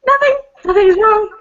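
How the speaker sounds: high-pitched, protesting denial; too-high cover-up voice; hasty denial. Acted. Character denies that anything is wrong.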